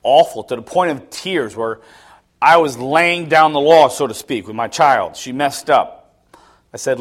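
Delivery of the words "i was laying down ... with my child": ordinary delivery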